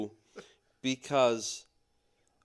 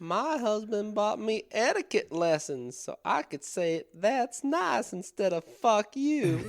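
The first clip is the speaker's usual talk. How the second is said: high, sugary Southern belle showing off; prim Southern lady; 10 semitones higher than usual. Acted. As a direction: play a mock-genteel Southern wife. Southern ladies joke.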